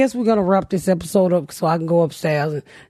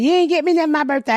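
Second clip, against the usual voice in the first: whiny mock complaint; 7 semitones higher, faster than usual. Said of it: "So she's like".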